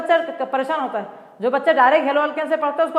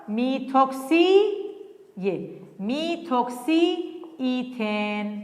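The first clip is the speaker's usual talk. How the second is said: slow naming; 3 dB quieter, much slower than usual. Shown as a demonstration. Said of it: "teacher spells out answer slowly".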